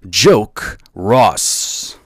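booming, slow show announcer. Acted. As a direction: announce him like a big event. Announcer intro for podcast.

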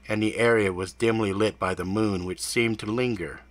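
ordinary delivery, nothing marked

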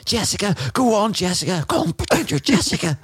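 high spectator cheer; crowd cheering. Retold or imitated. Runner imitating spectators cheering Jessica, high cheer.

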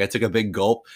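normal talking voice

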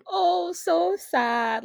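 high, very drawn-out pitying moan; mock lament. Real, not acted. Teller lamenting her ruined love story, mock sorrow. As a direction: stretch each word into a playful whine.

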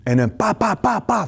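high mocking blah-blah excuses; high mock excuse-making babble; defensive excuses. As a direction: parody a defensive rambling rebuttal. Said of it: "rather than".

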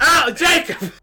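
high, loud exasperated yell; shouting after the friend who left. Real, not acted. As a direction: call out as another friend walks off. Calling after another leaving friend.

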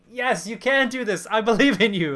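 rally him like a fan at the screen; cheering him on. Retold or imitated. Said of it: "I'm like".

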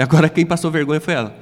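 loud triumphant declaration; shouting into mic. Retold or imitated. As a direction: boom it out on the microphone. Shouting on microphone.

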